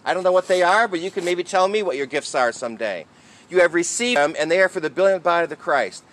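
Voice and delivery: ordinary talk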